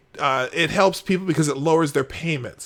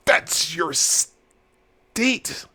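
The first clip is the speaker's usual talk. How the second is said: very slow, word-by-word delivery; emphatic; 3 semitones higher, much slower than usual. Real, not acted. Host telling Jake who is responsible, slowly emphatic. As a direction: spell it out, a long gap between words.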